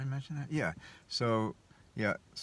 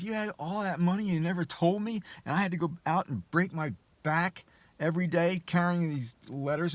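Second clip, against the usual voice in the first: much higher, louder mock outrage; mock angry husband; 11 semitones higher, 4 dB louder than usual. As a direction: rant like the betrayed husband, pitched up and loud. Husband's retold angry outburst.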